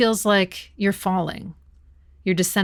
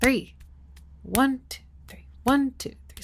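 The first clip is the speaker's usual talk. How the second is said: counting the waltz; 5 semitones higher than usual. Shown as a demonstration. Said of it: "now we have three".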